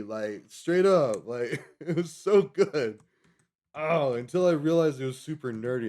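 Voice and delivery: loud, slow, gushing about the album; excited. Real, not acted. Telling viewers about his first CD, loud and excited. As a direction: burst out with delight, drawn out.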